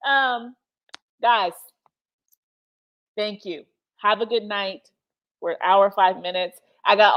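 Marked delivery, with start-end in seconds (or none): none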